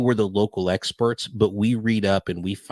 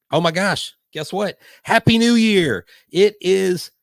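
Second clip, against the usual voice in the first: high-pitched, thrilled celebration; real New Year excitement; 9 semitones higher, 4 dB louder than usual. Real, not acted. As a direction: cheer wildly as midnight strikes. Midnight strikes live on the show.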